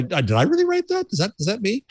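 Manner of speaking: high, startled self-doubt. Pretend. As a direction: squeak it in disbelief. Doubting own writing, quoted self.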